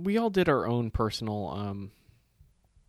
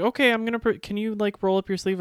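nurse voice; 11 semitones higher, 5 dB louder than usual. Retold or imitated. Imitating nurse.

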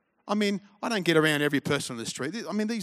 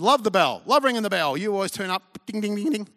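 eager ringing; 5 semitones higher, 6 dB louder than usual. Retold or imitated. Eager young believer voiced.

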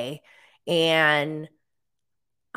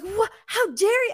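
much higher, indignant how dare you; be scandalised by their nerve; indignant outrage. Retold or imitated. Snapping at commenters sticking up for Joe, outraged. Worked up at defenders online.

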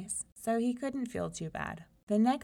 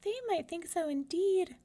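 soft mouse voice; 11 semitones higher than usual. Acted. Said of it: "she said softly".

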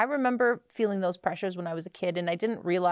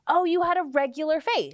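higher, louder mock discovery; mock surprise; 8 semitones higher, 4 dB louder than usual. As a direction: act out a sudden realization. Mock realization about Kendall's photos.

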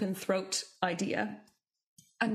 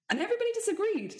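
exasperated; 12 semitones higher, much faster than usual. Real, not acted. Exasperated aside.

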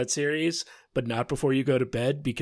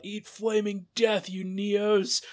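half-yelled defiant war cry, pitched up; imitated yell; 7 semitones higher, slower than usual. Podcaster retells Dover's last words to co-hosts, mild mock yell. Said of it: "yells out".

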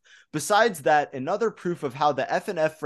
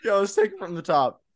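usual speaking voice

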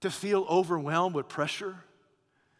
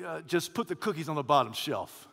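usual speaking voice

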